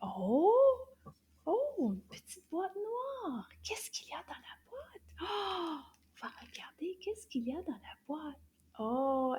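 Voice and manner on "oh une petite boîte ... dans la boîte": hushed, higher, building mystery; suspenseful whisper. Acted. Teacher building suspense over a small box, hushed whisper. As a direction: whisper like opening a secret box.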